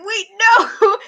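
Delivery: a high, sudden wait, no; shocked protest. Retold or imitated. Fan watching the pin break, shocked. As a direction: caught off guard by the escape. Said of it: "and I was like".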